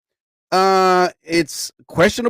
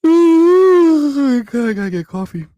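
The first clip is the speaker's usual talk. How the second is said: long drawn-out weary sigh, higher and louder; tired groan; 12 semitones higher, 7 dB louder than usual. Real, not acted. Host sighs to listeners he needs coffee, weary groan. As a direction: groan it out slowly, worn out.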